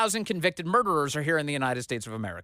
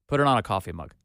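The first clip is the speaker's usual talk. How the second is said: ordinary talk, nothing marked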